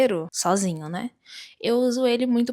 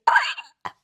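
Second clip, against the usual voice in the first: high, loud mock yelp of delight; acted-out lovestruck squeal; swooning squeal; 7 semitones higher, 4 dB louder than usual. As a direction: squeal as if weak at the knees. Acts out her reaction to accent.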